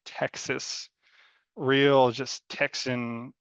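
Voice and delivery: slow Texan drawl on Texas and Texan; Texan accent. Acted. Describing Texan clothes, drifts into a slow Texas drawl. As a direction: lean into a rural Texas accent, slowly. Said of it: "I keep slipping to this accent".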